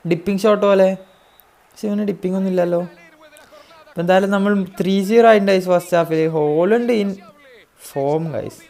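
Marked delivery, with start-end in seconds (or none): none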